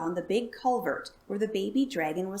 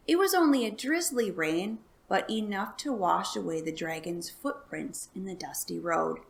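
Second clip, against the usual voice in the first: ordinary delivery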